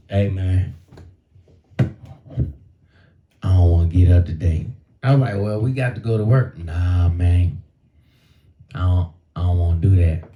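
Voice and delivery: low, grumbling knee that won't get up; lazy knee voice. Retold or imitated. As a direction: voice the stubborn knee, low and reluctant. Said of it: "My knee be like this".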